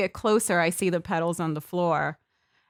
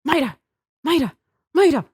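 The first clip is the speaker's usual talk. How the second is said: short, higher retold calls; lightly mimicked calling of a name; aunt calling mom; 6 semitones higher, 3 dB louder, faster than usual. Aunt in the video calling mom back, lightly imitated calls. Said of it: "you hear in the video my aunt".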